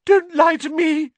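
very high, loud wail; piercing, wounded outburst; tearful gasp. Acted. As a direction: cry out the accusation in tears. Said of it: "Myrtle gasped".

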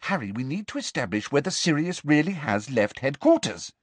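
earnest, urgent reasoning. A narrator's reading. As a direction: press the point urgently. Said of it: "she said urgently".

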